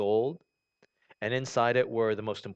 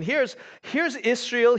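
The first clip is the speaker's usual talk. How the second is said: ordinary talk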